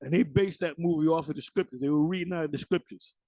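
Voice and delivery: ordinary talk, nothing marked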